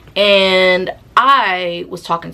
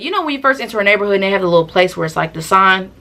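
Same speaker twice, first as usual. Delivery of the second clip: ordinary talk, nothing marked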